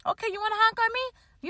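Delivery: high, soft, teasing dare; mock taunt. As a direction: goad the driver sweetly, daring him on. Taunting a honking driver.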